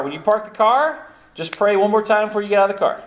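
ordinary delivery

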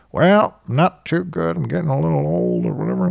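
a hint of a tired old guy shrugging it off; old man voice. Old client chatting with staff.

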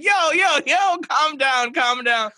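high, loud, slowed mock soothing; mock calming. Host voicing Josh calming him down, high mock soothing. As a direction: imitate a friend talking you off the ledge.

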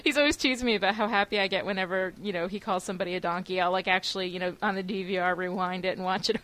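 normal talking voice

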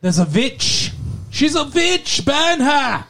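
high shouting mob cry; angry villager. Pretend. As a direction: shriek like a witch-hunting peasant. Witch-hunt villager parody.

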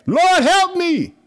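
high, loud, slow wail; drawn-out anguished cry for help; desperate plea. Shown as a demonstration. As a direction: cry out to God from trouble. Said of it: "You can say it".

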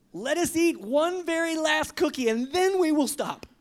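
high, loud storybook plea; urgent urging. A narrator's reading. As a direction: coax a friend into one last indulgence. Frog urges one very last cookie.

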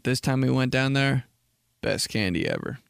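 ordinary talk

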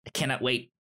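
ordinary talk

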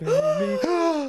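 sharp high gasp of surprise; excited gasp. Pretend. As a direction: gasp like the ring just appeared. Ring reveal gasp.

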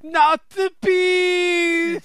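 high, long-drawn wail of mock agony; hammed-up film-star meltdown, stretching every word; Nicolas Cage. Friend begging for a movie moment, high hammed-up wail. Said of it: "pull a Nicolas Cage".

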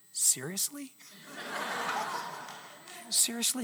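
soft, amused hushed seriously; God whispering. Retold or imitated. God whispers to the hesitant giver, softly amused. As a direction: breathe it like a teasing whisper. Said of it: "whisper in my ear".

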